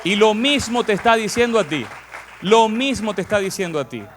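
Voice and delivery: punchy, high-energy exhortation; emphatic preaching. Real, not acted. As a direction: proclaim it forcefully to the congregation. Preacher drives message home to congregation.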